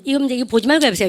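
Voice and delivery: normal talking voice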